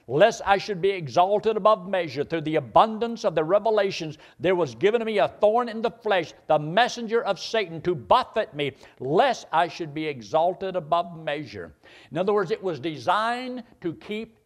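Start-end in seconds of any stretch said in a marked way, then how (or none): 0.1-11.7 raised, ringing scripture reading. Acted. Preacher reading the verse aloud to his congregation, raised and ringing. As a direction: read the verse out loud to the congregation.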